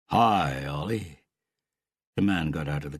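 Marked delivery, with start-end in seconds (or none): 0.1-1.2 lightly voiced Canadian uncle, loud greeting; touch of deep Canadian in a hello; deep Canadian accent. A narrator's reading. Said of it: "his uncle's deep Canadian accent".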